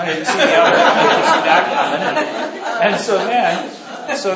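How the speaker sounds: real laughter, caught off guard; breaking into a laugh while retelling. Real, not acted. Laughing at being kissed.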